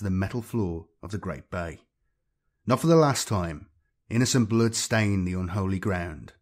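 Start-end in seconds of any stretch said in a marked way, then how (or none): none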